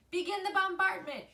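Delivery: high, loud commanding owl. A narrator's reading. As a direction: launch the attack from above. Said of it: "ordered Swooper".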